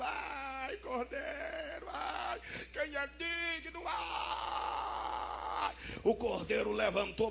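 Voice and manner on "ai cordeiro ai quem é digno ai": high, wailing sobs of John; a touch of crying, voice pulled high; mock weeping. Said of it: "começou a chorar".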